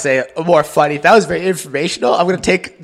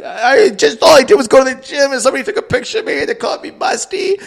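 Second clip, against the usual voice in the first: higher, louder, mock-teary whine; mock sobbing teen; 10 semitones higher, 3 dB louder than usual. Teen daughter whining to her dad, mock sobbing. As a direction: imitate a crying girl, hammed up. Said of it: "she's sobbing".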